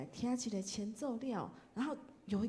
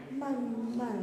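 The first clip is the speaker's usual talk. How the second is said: slow, higher, stretched syllables; drawn-out slowness; 4 semitones higher than usual. Shown as a demonstration. Teacher explaining what the hymn word means, drawn-out and slow.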